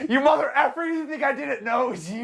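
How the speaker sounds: high angry laughing rant; hammed-up furious Fletcher; mock rage. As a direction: spit it out like the furious conductor. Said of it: "And then he's like".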